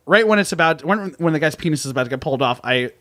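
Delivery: ordinary delivery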